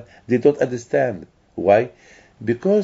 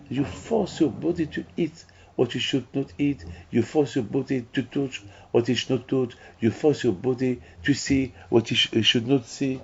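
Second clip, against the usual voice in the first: ordinary talk, nothing marked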